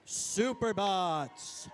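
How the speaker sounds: slow, drawn-out arena call; stretched hype announcement. Real, not acted. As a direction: roll the name out for the crowd. Announcing team name to crowd.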